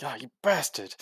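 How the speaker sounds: mild mock yell. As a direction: let out a half-contained yell. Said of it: "lets out kind of a yell".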